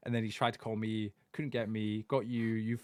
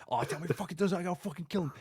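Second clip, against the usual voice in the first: higher pitched imitation of an enraged dad; mock furious dad; 7 semitones higher than usual. Son retelling dad's threat, mock angry rant.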